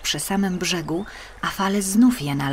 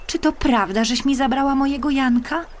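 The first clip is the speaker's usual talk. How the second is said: put-on high, pleading child's question; little girl asking; 8 semitones higher, 3 dB louder than usual. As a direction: ask the river like a small girl begging. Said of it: "zapytała".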